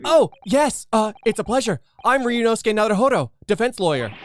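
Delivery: put-on high, loud polite young lawyer. The lawyer answering the governor, high and polite. Voicing the Japanese lawyer character.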